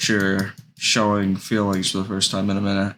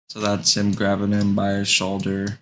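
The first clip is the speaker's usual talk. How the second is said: usual speaking voice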